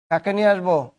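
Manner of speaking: normal talking voice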